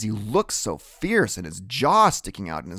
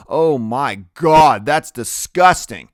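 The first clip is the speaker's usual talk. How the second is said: loud, slow, higher cry of disgust; disgusted girl; 3 semitones higher, 10 dB louder, slower than usual. A narrator's reading. A girl in the hall recoiling from Freak, loud and slow.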